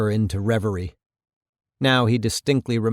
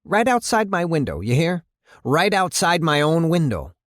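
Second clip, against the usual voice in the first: high-pitched, indignant mayor; 7 semitones higher than usual. Acted. Mayor complaining of trouble outside his own window, indignant. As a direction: drive the complaint home in a raised pitch.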